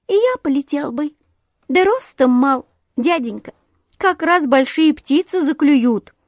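high, piping small sandpiper; small high-pitched voice; thin little bird voice. A narrator's reading. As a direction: explain meekly as a tiny bird. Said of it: "тоненький голосок".

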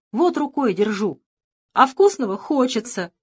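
loud whiny shout. Acted. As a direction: yell and complain like a spoiled boy. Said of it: "кричал".

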